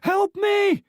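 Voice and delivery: high, loud panicked cries for help; cry for help. Acted. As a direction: shriek for help as if terrified. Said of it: "she cried".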